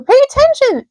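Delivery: high, loud scream of a name; high loud pig scream. A narrator's reading. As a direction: scream to wake him. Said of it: "screamed Wilbur".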